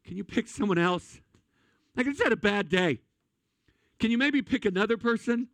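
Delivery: rising, pleading mock whine; whiny Job. Job begging God to choose someone else, mock-whiny. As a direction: plead like a tired man begging off. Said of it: "Job would sit there and go".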